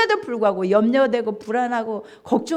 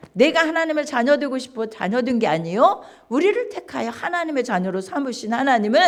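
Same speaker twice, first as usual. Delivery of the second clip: usual speaking voice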